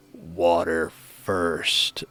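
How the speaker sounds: low, very slow, flat-out deadpan. Retold or imitated. Hungover friend answering the waitress, slow low deadpan. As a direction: hungover friend dead serious over water. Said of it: "looks at her deadpan and goes".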